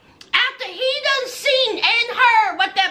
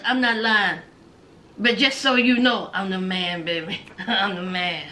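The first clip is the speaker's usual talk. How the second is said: put-on much lower and softer Borat-style accent, laughing through it; Borat impression; 13 semitones lower, 3 dB quieter than usual. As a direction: croon it low and smug like Borat, cracking up. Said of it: "He sounds just like Borat".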